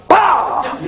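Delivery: sudden high mock shout of a toddler; startled bark. Toddler shouts bah at the nudging dog, high mock yell. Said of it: "The child goes".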